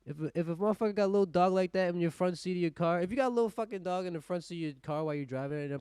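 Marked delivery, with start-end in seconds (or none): none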